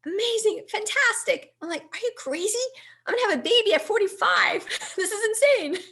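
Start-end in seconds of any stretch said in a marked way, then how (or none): 0.0-1.5 husband overjoyed. Retold or imitated. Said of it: "and he says". 1.8-5.8 much higher, louder, fast frantic outburst with a laugh; frantic high outburst to husband about a baby at forty-five; panicked disbelief. Retold or imitated.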